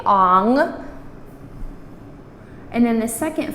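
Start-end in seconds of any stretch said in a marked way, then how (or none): 0.1-0.9 put-on ong said like a sympathetic aww over a cute baby. Shown as a demonstration. Teacher models ong like aww for a cute baby, careful pronunciation. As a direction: say ong like feeling sorry for someone. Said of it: "as in feeling sorry for someone".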